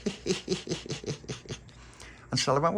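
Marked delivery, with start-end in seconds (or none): 0.0-1.5 soft, high stifled giggle; sniggering. A narrator's reading. Little Poppy giggling quietly at Stella. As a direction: snigger behind your hand. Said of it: "little Poppy's going".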